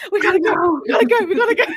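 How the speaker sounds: high, loud rushed cry; hammed-up alarm as she has to go; imitating her own frantic sign-off, half shouting; mock panic. Retelling frantic call interruption.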